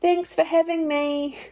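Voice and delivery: put-on high, slow, drawn-out little girl thank-you; a child dutifully thanking her friend's mum, in a high, slow sing-song; sing-song child politeness. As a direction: chirp it like a drilled child reciting manners. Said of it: "I'd also do it in this tone".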